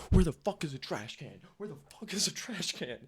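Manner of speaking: frantic high yell, imitating the buddy; panicked yell. Buddy scrambling for a trash can, frantic high yell. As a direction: shout it like you're hunting for a bin in a panic.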